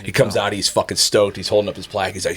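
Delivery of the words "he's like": ordinary talk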